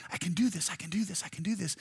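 put-on a toddler quietly psyching itself up; mock self-pep talk.

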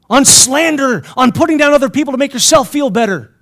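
loud, high preaching; forceful rebuke. Real, not acted. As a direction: call out their sins at full force. Preacher rebuking future pastors.

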